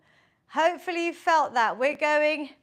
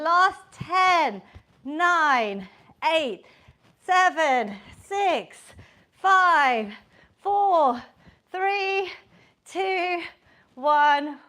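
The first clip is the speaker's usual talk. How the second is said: loud countdown; 4 dB louder, much slower than usual. Real, not acted. Coach counting down final seconds.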